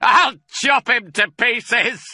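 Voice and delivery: slow, high, loud yell; drawn-out menacing holler; shouted threat. A narrator's reading. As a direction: yell it savagely, drawing the words out. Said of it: "shouted Bunce".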